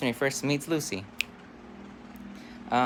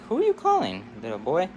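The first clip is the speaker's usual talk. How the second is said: inquisitive counselor; 4 dB louder than usual. Acted. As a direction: pitch it way up, dropping on the address. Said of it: "one of the counselors".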